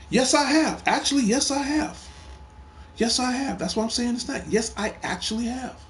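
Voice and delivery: high-pitched, emphatic rebuttal; insistent protest. Real, not acted. Insisting back when contradicted by someone.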